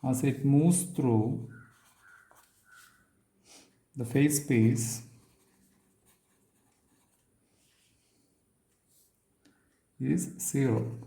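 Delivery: very long pauses between short phrases; slow, piecemeal statement. Real, not acted. Lecturer stating a theorem to students, slowly and piece by piece. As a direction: dictate it slowly, a few words at a time.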